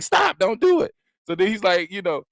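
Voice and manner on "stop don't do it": very high-pitched mock plea; mock pleading girlfriend. Imitating a girlfriend stopping her boyfriend, pleading. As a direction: imitate an alarmed girlfriend begging.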